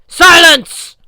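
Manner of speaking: huge booming command; commanding roar. A narrator's reading. Goddess Ran silences the cowering giant, roaring. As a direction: cut him off with one roar.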